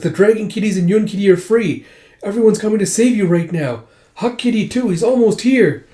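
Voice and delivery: high, excited delivery of good news; urgent reassurance. Acted. Rescuer telling the princess help is coming, urgent.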